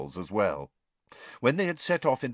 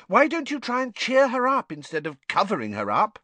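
put-on exasperated girl; 13 semitones higher, 3 dB louder than usual. A narrator's reading. Hermione exasperated.

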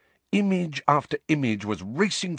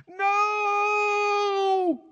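long, very high drawn-out cry; anguished cry. Acted. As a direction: cry out in horror, sustaining the vowel. Harry cries out at painful memory.

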